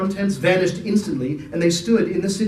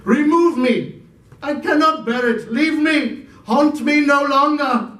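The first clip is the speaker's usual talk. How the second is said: higher, louder anguished pleading; desperate cry; 9 semitones higher, 4 dB louder than usual. Acted. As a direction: beg the spirit to stop. Scrooge's desperate outcry to spirit.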